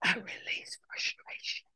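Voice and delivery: slow, breathy whisper of each phrase; whispered affirmation. Shown as a demonstration. Leader whispering affirmations for the group to repeat. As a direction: hush it as a calming model for the listeners. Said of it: "Repeat after me".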